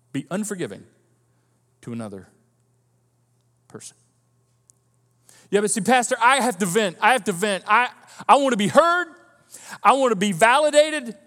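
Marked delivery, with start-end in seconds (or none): 5.5-11.2 loud, insistent complaint, rising in pitch; hammed-up plea to the pastor; mock venting. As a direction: imitate someone demanding to be heard. Acting an insistent complaining churchgoer.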